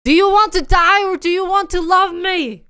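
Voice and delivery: high, loud hammed-up demand; drama hero ultimatum. Retelling the car scene, hero's hammed-up demand. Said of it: "the guy goes".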